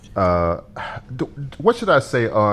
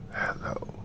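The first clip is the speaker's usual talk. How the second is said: very soft, low whispery impression; 9 semitones lower, 14 dB quieter than usual. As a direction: greet in a hushed, low imitated jazzman voice. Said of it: "I was imitating [name]".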